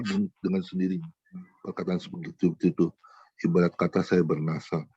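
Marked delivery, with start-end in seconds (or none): none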